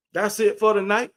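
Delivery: normal talking voice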